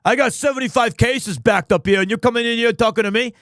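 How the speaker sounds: a hint of a fast, irritated Bronx cop; overworked New York detective, lightly voiced; Bronx accent. Streamer voicing Gumshoe complaining about cases, Bronx accent. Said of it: "a himbo Bronx".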